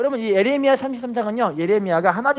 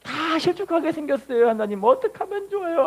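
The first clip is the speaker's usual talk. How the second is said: higher, lightly crying plea; mock tearful prayer; 7 semitones higher than usual. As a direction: plead to God with a hint of tears. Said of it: "막 울면서 기도하면서".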